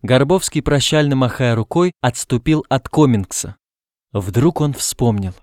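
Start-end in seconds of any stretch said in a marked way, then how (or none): none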